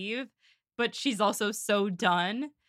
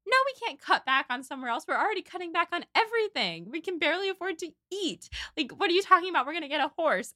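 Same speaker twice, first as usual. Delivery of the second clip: fast, higher-pitched exasperated outburst; Eleanor about to snap; 5 semitones higher, faster than usual. Retold or imitated. Acting out a sister refusing to cut back, about to snap. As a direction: imitate someone losing patience, words tumbling out. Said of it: "Eleanor is about to snap".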